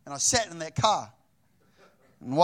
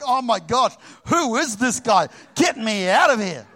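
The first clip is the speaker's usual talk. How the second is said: higher and louder, half-laughing; mock panicked date; 5 semitones higher, 6 dB louder than usual. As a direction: ham up her alarm at this guy. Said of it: "she's like".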